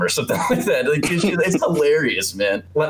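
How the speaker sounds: normal talking voice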